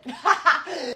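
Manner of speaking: loud, high burst of laughter; real laugh. Real, not acted. Laughs at own punchline.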